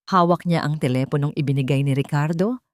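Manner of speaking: ordinary delivery, nothing marked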